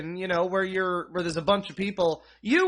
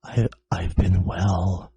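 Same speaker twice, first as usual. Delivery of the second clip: much lower, slow, stiff deadpan; awkward silent guy; 13 semitones lower, 5 dB louder, slower than usual. Retold or imitated. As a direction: drop the voice way down and drag it out awkwardly. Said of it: "and you just say".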